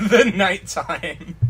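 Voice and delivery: slow, laughing, leaning on "The"; real laugh while repeating "The nighttime"; laughing mock emphasis. Real, not acted. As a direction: savour "The nighttime" through laughter. Mocking the phrase "the nighttime.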